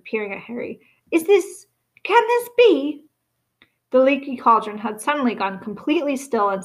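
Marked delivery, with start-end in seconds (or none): 1.1-3.0 put-on high, slow, wondering old voice; barely believing his eyes; awed disbelief. A narrator's reading. The bartender, recognizing Harry, asks in high awed disbelief.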